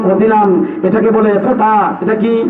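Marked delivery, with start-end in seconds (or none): none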